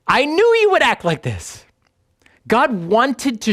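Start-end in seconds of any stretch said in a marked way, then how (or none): 0.1-1.6 high-pitched mock outrage; hammed-up accusing whine, pitch leaping; so angry. Jonah complaining to God about his mercy, angrily. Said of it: "he's so angry".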